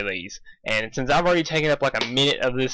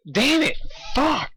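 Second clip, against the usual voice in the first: frustrated curse; 13 semitones higher, slower than usual. Real, not acted. Frustrated at misreading.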